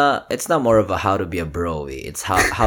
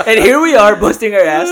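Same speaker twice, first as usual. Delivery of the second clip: laughing; 13 semitones higher, 10 dB louder than usual. Real, not acted. Joking about working out, laughing.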